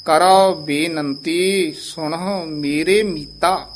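slow, every word clear; careful slow recitation. Shown as a demonstration. Teacher recites the correct line for the student. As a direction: spell it out slowly. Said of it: "ਬੇਨੰਤੀ ਲਾਂ ਦੇ ਨਾਲ ਉਚਾਰਨ".

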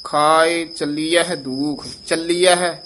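slow, deliberate ਖਾਏ ਚੱਲੀਐ ਦੁਖ; slow teaching recitation; slow careful recitation. Shown as a demonstration. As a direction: spell each word out slowly for learners. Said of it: "ਇੱਥੇ ਵਿਸਰਾਮ ਹੈ".

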